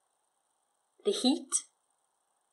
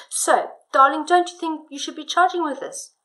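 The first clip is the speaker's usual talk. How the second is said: husband's voice; 5 semitones higher, 6 dB louder than usual. Shown as a demonstration. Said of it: "Do you see how that works?".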